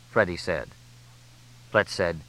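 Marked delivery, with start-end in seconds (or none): none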